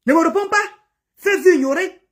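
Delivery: high, firm command; angry order. Real, not acted. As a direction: order her to drop it. Telling rival to back off.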